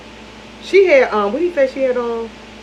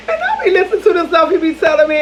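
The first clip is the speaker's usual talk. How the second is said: laughing through the words, pitch up; can't keep a straight face recounting his talk; 6 semitones higher than usual. Real, not acted. Telling viewers about her ex, laughing through it.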